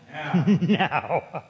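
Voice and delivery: word broken up by laughter; real laugh in the voice. Real, not acted. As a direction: chuckle through the word. Laughs while answering own question.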